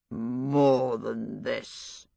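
low, slow, mushy voice of a stuffed scarecrow. A narrator's reading. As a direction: speak as if your mouth were full of damp straw. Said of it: "its mushy voice".

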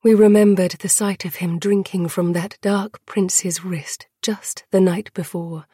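ordinary delivery, nothing marked

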